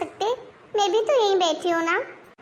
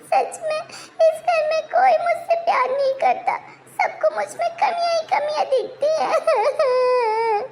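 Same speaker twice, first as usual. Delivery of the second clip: high, sobbing wail with a slow, teary drag; melodramatic sobbing; 7 semitones higher than usual. Acted. Wife wailing to husband that nobody loves her, melodramatic sobbing. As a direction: cry out that nobody in the house loves you.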